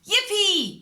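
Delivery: high, loud little cheer of joy. A narrator's reading. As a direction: squeal it out with delight. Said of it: "cheered Peppa".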